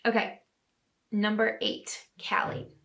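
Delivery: normal talking voice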